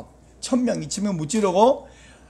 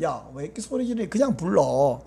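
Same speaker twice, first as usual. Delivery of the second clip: a touch of quiet, lower-pitched reproof; mildly chiding parent; 5 semitones lower, 5 dB quieter than usual. Acted. Parent tells shouting child to just call, mild low chiding. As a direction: lightly voice a parent, softer and quicker.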